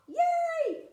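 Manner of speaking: high, loud squeal of joy; excited cheer. Real, not acted. Art teacher cheering her live viewers, excited squeal.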